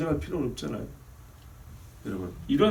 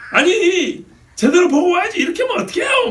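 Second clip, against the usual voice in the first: much higher and louder mock yell in Busan dialect; shouted Busan scolding; 10 dB louder than usual. Other driver yelling at the pastor in Busan dialect, shouting. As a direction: shriek it like an angry Busan driver. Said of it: "나한테 소리를 질렀어요".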